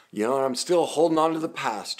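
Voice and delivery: usual speaking voice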